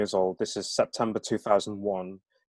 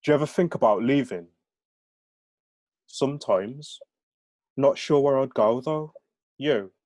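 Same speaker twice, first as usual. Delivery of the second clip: light, lilting Brummie dialogue; Brummie accent; 5 semitones higher, 4 dB louder than usual. Acted. Novelist reading two friends' chat, in Brummie accent. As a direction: read it in a Birmingham accent. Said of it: "aren't familiar with the Brahmi accent".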